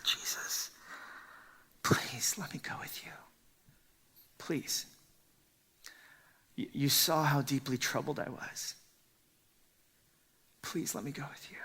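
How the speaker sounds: soft, hushed plea of a broken man; whispered begging. Acted. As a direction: plead in a near whisper. Preacher voices the man begging.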